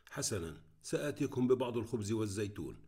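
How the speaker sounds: ordinary delivery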